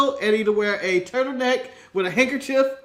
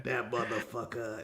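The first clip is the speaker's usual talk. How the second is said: low, soft curse fading away, slowing; mock Booker T; 6 semitones lower, 11 dB quieter than usual. Imitating Booker T cursing at Mike Rowe, fading. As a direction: mutter the curse lower and slower each time. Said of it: "He's like".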